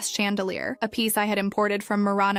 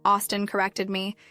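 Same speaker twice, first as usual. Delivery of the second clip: ordinary delivery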